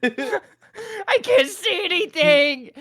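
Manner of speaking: high, slow, loud cartoon wail; wailing goblin. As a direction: howl in hammed-up goblin agony. Scrimblow blinded by thrown dust.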